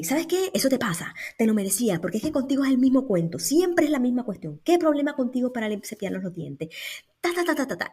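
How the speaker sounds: high, rapid-fire mock scolding; acted tirade of reproach, rushed and high; scolding adult. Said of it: "imagínate que ese adulto, en vez de eso, se acerca y me dice".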